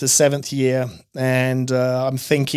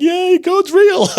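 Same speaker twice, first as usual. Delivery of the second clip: much higher, louder, slow mock cheer from wife; 5 dB louder, slower than usual. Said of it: "my wife's like".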